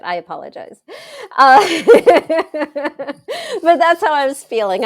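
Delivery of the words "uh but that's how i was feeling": laughing through the words; loud laugh spilling into the line; real laughter while talking. Real, not acted. Laughs at own drama.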